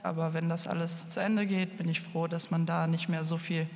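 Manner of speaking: ordinary delivery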